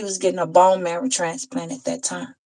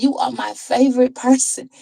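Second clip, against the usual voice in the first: higher, lightly imitated child; little boy's voice; 6 semitones higher than usual. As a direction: lightly voice a boy confiding, pitched up. Retells a little boy's praise.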